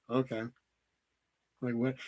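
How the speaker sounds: low, soft, underwhelmed reply; quiet, dismissive mumble; unimpressed. Retold or imitated. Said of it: "and she was not".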